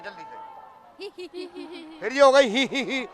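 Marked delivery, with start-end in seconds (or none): 2.5-3.1 mock fake giggle. Shown as a demonstration. Host mimics contestant's fake hee-hee giggle. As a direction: mimic a forced tee-hee. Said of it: "ये भी बना".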